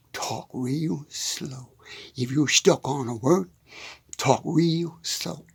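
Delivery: retold psychologist, hushed and very slow; a soft, slow imitation of the therapist's advice; slow whisper. Psychologist tells the stammering farmer, in a slow hush. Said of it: "He said".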